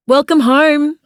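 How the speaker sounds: warm welcome, lifted and bright; warm greeting. Acted. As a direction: greet him warmly from below. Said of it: "I say warmly".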